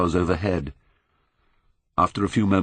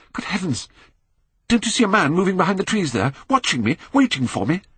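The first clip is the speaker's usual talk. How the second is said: higher, fast panicked outburst; terrified; 11 semitones higher than usual. A narrator's reading. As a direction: blurt it out in sudden terror. Terrified, pointing with trembling hand.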